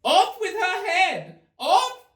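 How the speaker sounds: queen screaming in fury; screamed. Acted. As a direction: scream it out at the top of the voice.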